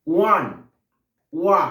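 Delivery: ওয়ান said alone, then cut back to its opening ওয়া; ওয়ান, pause, then just ওয়া; sounded like wa. Shown as a demonstration. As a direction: isolate the "wa" sound that starts ওয়ান. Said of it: "ওয়ের উচ্চারণ ওয়ার মতন হলে".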